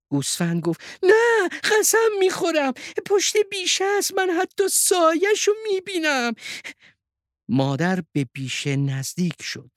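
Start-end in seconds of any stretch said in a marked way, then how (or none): 1.0-6.3 very high-pitched, emphatic character voice; insistent sheep. Acted. Sheep swears to the mother it is true, insistent high voice.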